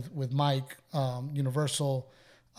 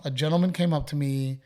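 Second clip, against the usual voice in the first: ordinary talk, nothing marked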